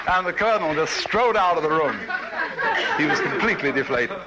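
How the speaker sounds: words bubbling with laughter; laughing while talking. Real, not acted. Telling the punchline aftermath.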